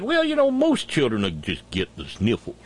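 low, dismissive mock politician; mocking the governor. Host quoting the governor on children, mockingly dismissive. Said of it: "Governor Taint Reeves said".

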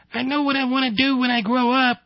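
put-on squeaky high child voice; little boy. Son tells father his ambition, little-boy voice. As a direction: pipe it up like an eager small son.

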